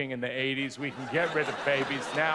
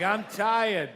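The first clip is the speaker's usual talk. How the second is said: put-on higher-pitched toddler with a Boston accent; 6 semitones higher, 4 dB louder than usual. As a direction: play a whiny Boston toddler. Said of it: "toddlers sort of have a Boston accent".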